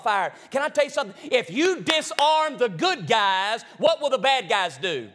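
loud, high preacher's call; preaching at full force. Acted. Preaching against seducing spirits.

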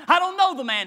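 insistent denial. Retold or imitated. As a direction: deny it hard. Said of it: "denies him".

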